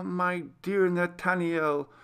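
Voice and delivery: ordinary delivery, nothing marked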